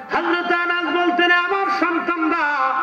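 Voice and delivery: high, sustained melodic preacher's chant; waz preaching. Acted. (উঁচু সুরে ওয়াজ)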